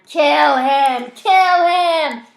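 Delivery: high, very loud drawn-out cries; frenzied scream. A narrator's reading. Voices in Zach's head demanding death, screaming. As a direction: scream it, stretching each word.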